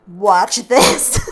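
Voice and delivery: laughing through the words. Real, not acted. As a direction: laugh while showing off the dice. Proud reveal of the dice.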